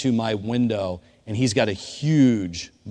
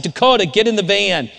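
urgent shouting; 8 semitones higher, 5 dB louder than usual. Retold or imitated. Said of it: "I said".

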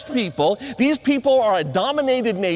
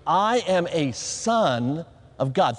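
slow, lower-pitched declaration; leading a recitation; 5 semitones lower, slower than usual. Shown as a demonstration. Preacher has the guys repeat after him, slow recitation. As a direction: spell it out slowly for others to repeat. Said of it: "say this with me".

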